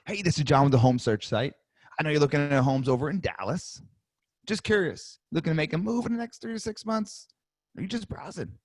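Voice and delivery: curious lift, casual and light; sales call tonality. Shown as a demonstration. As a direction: sound curious, not pushy. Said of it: "Hear my tonality".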